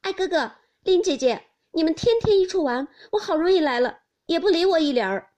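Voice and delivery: high, loud, playful pouting; eager, high-pitched mock grievance; laughing young cousin's complaint. A narrator's reading. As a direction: call out to them and complain playfully. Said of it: "笑道".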